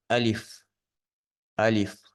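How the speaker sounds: أليف said carefully as an isolated letter name; letter-name drill. Shown as a demonstration. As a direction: model أليف clearly for learners to repeat. Teacher models letter names for repetition.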